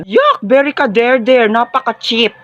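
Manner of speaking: high squealed yuck of disgust; shrill squeal of disgust. Acted. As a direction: recoil from the smell, shrieking. Disgusted reaction to perfume.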